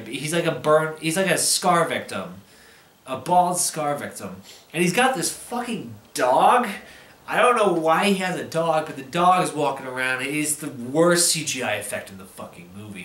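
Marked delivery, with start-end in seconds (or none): none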